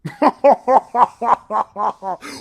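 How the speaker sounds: high, loud burst of laughter; thrilled laugh at the story's twist; delighted laugh. Real, not acted.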